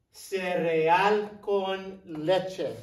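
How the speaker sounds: "Cereal con leche" very slowly, each word clear; slow it right down for the class; slow modeling. Shown as a demonstration. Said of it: "teacher models slowly".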